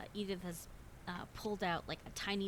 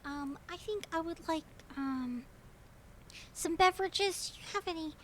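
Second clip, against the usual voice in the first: much higher, fluttery prim lady voice; genteel lady; 9 semitones higher, 9 dB louder than usual. Acted. Edith asking the diner what drinks they have, prim and high. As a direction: flutter politely, a bit overwhelmed. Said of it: "Edith continues and goes".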